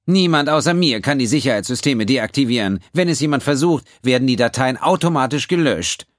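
high, loud, superior; prim accountant. A narrator's reading. The accountant answering Quinn, high and superior. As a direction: correct him coldly.

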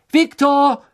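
high, loud drawn call. Acted. Harry searching the forest for Krum, calling loudly. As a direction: shout the name into the distance. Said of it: "rief Harry".

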